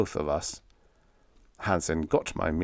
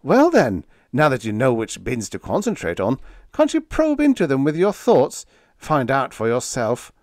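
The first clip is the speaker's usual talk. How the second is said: high, loud triumphant challenge; lifted voice, scoring a point; 6 semitones higher, 7 dB louder than usual. A narrator's reading. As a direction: press your point, triumphantly. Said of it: "Biggs said triumphantly".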